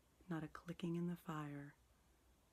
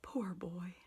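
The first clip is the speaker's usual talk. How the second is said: pity; 3 dB louder than usual. A narrator's reading. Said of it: "in pity for his former self".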